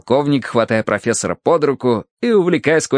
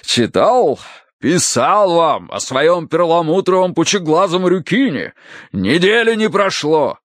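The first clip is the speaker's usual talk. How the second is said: slow, emphatic colonel, pitched up; booming colonel; 7 semitones higher, slower than usual. A narrator's reading. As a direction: draw it out with hearty enthusiasm. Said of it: "пробасил полковник". (полковник, громогласно и с расстановкой)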